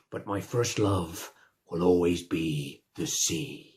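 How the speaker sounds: a dreamy sigh of love for the sea; mock sentimental. Sentimental punchline to pirate joke.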